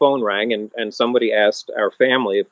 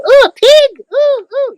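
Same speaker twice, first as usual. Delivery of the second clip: shrill high squeal of alarm, much louder; mock-shriek like a startled kid; shrieking visitors; 10 dB louder, slower than usual. Visitors shrieking at the pig, loud high squeal. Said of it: "they go".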